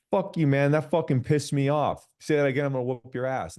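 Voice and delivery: loud, high hammed-up bro rage; mock macho anger. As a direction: bark it like a tough guy. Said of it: "we have this hyper masculinity".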